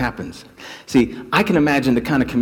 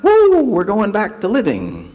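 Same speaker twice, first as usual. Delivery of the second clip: excited cheer; 8 semitones higher, 5 dB louder than usual. Acted. Lepers celebrating their healing.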